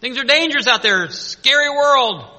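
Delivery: voicing Satan's taunt. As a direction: sneer like the devil, up and loud. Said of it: "He wants us".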